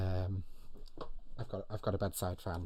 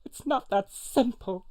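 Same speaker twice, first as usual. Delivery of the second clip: high, slow, pained-style retort; replier's voice; 14 semitones higher, 5 dB louder, slower than usual. Acted. Host playing the replier in a film argument, high slow retort. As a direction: answer high and drawn out, less loud. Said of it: "I'll follow it up with the replier's line".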